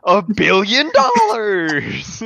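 announcer voice. Pretend. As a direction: hype the weight like a ring announcer. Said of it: "weird, catchy names".

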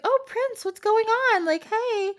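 high, sugary fake-friendly greeting; fake-sweet stepmom. Stepmom sweetly greets the prince, fake sugary and high. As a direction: gush like a scheming stepmother playing nice.

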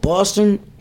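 experimenting with a Boston accent; unsure stab at Boston speech. Shown as a demonstration. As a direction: test out the Boston vowels, questioning. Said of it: "Is that how they say it?".

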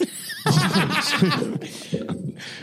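evil cackle. Pretend. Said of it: "she laughed to herself".

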